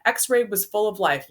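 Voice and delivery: ordinary delivery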